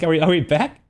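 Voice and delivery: ordinary delivery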